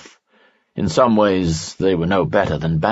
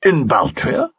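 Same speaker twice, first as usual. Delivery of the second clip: higher, startled question; shocked exclaim; 11 semitones higher than usual. A narrator's reading. Lee stunned by news of the ambassador, high exclaim. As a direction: blurt it out in disbelief. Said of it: "Lee exclaimed".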